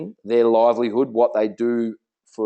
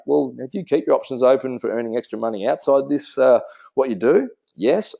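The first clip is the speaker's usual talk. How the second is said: ordinary talk, nothing marked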